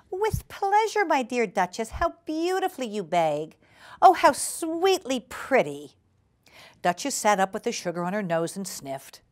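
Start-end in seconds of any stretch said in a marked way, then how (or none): 0.1-5.9 high, delighted genteel hostess; gushing hostess Ribby. Acted. Ribby admiring her guest's begging, gushing hostess voice. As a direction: coo compliments, pitched well up.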